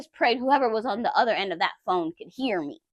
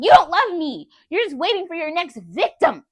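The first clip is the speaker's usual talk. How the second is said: shouting at ex across the apartment, angry yell; angry accusing yell; retold yelling; 5 semitones higher, 5 dB louder than usual. Yelling at ex that she doesn't love me, mock angry shout. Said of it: "I was just still yelling".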